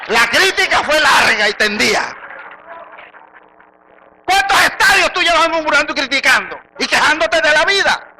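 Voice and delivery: fiery preaching, high and very loud; prédica a toda voz. Real, not acted. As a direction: hurl the rebuke at the congregation at full force. (predicación encendida, a gritos)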